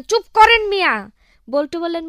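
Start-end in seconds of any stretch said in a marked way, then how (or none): 0.0-0.9 high, loud angry snap; angry officer. Acted. Officer angrily silences Boltu in the interview, high and loud. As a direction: shut him up in a rage. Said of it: "officer আরো রাগান্বিত হয়ে বলেন".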